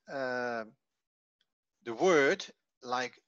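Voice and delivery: ordinary talk, nothing marked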